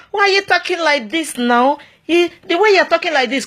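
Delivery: a prim woman telling him off; scolding woman. Retold or imitated. Women scolding the preacher.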